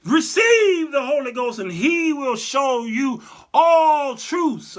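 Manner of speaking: slow, loud, solemn preaching quote; solemn Jesus quote. Acted. Preacher voicing Jesus breathing on disciples, slow solemn declaration. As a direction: intone it like a sermon.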